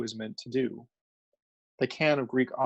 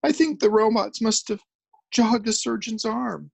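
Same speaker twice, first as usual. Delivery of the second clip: crying; 9 semitones higher, 3 dB louder than usual. A narrator's reading. Journalist crying as he tells the crew. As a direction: sob out the words. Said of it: "He started to cry".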